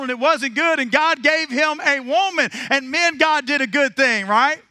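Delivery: high, loud, ringing preacher's cadence; preaching at full force. Acted. Preacher firing up the congregation, full-force preaching. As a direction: preach it out to the whole room. Preacher building up his sermon.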